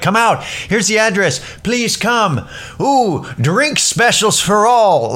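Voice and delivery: promoter hype. Retold or imitated. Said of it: "really, really promote it".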